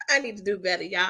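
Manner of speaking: fast, laughing through the words. Real, not acted. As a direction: giggle while confessing. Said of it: "laughing at herself".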